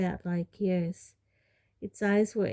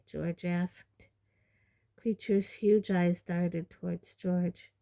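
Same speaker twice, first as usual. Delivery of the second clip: ordinary delivery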